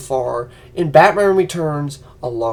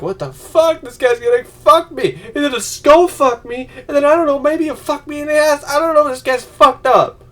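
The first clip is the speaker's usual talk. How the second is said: mock freaked out; 8 semitones higher, 4 dB louder than usual. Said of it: "we're like".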